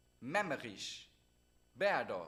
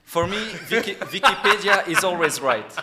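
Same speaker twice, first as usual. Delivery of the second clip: loud laughing claim, much louder; 5 semitones higher, 13 dB louder than usual. Real, not acted. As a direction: laugh it out loudly while teasing. Laughing host teasing over Wikipedia.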